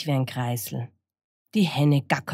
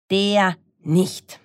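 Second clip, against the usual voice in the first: firm refusal; 4 semitones higher, 4 dB louder than usual. Acted. Animals reject the fox.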